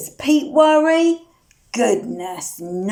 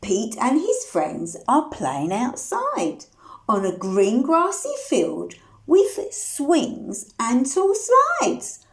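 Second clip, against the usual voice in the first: ordinary delivery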